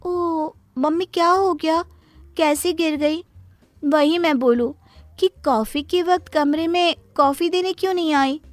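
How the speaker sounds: put-on high, pouty teen daughter annoyed at her father; spoilt daughter. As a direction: whine it like a spoilt girl. Minni talks to injured mother. (ऊँची आवाज़ में बिगड़ैल बेटी)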